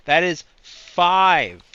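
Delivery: slow, loud, every word hammered, "That is five"; emphatic insistence. Real, not acted. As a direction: spell out "That is five" slowly and loudly. Insisting on the count.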